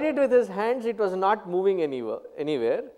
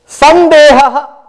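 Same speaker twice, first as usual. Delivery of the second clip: very loud, higher, drawn-out recited word; booming recitation; 7 semitones higher, 17 dB louder, slower than usual. Shown as a demonstration. Teacher reciting a Sanskrit term to students, booming recitation. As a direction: intone it slowly at full volume.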